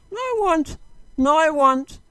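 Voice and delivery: high-pitched, insistent refusal; mock protest. Mailbagger answering an imagined objector, high mock protest.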